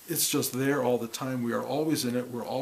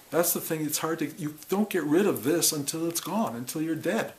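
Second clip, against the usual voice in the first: ordinary talk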